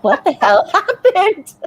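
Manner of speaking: remembering the morning after, laughing in disbelief. Retold or imitated. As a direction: laugh through the embarrassed question. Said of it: "I'm thinking".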